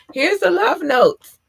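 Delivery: high, loud, drawn-out announcement; segment announcement. Real, not acted. Streamer opening her love notes for listeners, high loud announcement. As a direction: sing out the segment name, slowly.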